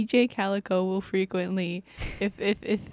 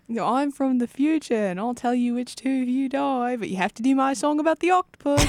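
high-pitched cartoon imitation, building louder; cartoon quote voice; 5 semitones higher, 3 dB louder than usual. Co-host quoting the cartoon about [name]'s song, high mock character voice. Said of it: "would you like to quote the, the cartoon".

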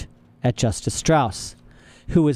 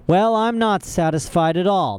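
higher-pitched, affronted banker; indignant Mister Poe; 8 semitones higher than usual. A narrator's reading. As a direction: protest as an outraged official.

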